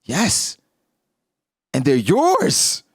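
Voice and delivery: lightly voiced proud mother. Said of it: "she says".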